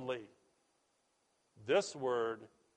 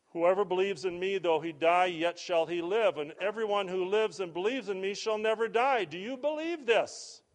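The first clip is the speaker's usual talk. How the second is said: raised, ringing preacher's reading, rising to the question; proclaimed scripture; 4 semitones higher, 5 dB louder than usual. Acted. Preacher proclaiming Jesus' promise to grieving friends, loud and ringing. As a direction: lift the promise high and declare it.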